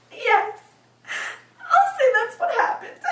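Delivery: high, loud wail of agreement; acted pained cry. Hurt girl agreeing to brothers' lie, pained cry. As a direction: give in through the pain.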